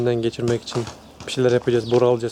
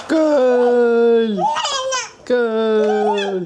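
excited high cheer; 10 semitones higher, 4 dB louder than usual. Real, not acted. Cheering daughter's ball kicks.

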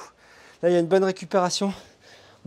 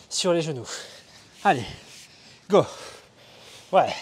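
ordinary talk, nothing marked

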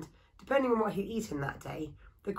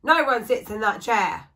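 bellow; 3 semitones higher, 8 dB louder than usual. Acted. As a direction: roar at her to stop. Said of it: "bellowed the woodcarver".

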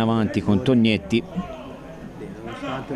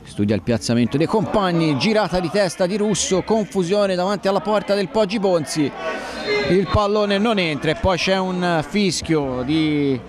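excited play-by-play; 6 semitones higher than usual. Acted. Near miss commentary.

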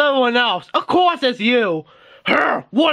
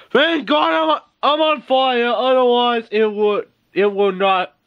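ordinary delivery

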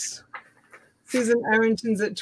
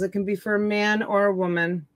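normal talking voice

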